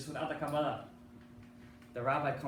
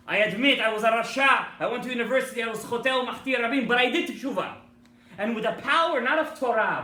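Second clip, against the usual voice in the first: loud, higher proclamation of repentance; fervent declaration; 8 semitones higher, 9 dB louder than usual. Acted. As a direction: declare it before the whole shul. Rabbi answers the dibuk.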